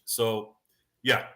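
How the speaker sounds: ordinary talk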